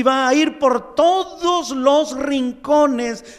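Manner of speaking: fervent preaching build; preaching at full force. Acted. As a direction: climb the chain of consequences.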